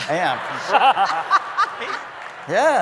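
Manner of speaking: laughing through the words, up high; laughing reply. Real, not acted. Actor admits Brooklyn roots, laughing high. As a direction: chuckle it out, amused at himself. Laughs at his own accent.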